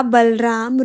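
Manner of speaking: ordinary talk, nothing marked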